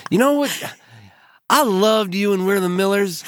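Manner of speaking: hammed-up fan gushing way up high. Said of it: "he goes".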